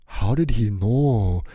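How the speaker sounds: loud, very slow mocking stranger; second stranger asking his friend loudly; loud mate. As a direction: drag it out to a friend, amused. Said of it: "the other guy goes".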